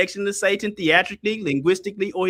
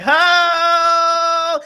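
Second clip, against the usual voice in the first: mock cheer; 9 semitones higher than usual. Preacher mocking the one-ho laugh with a cheery call. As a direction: toss off a bouncing mock greeting.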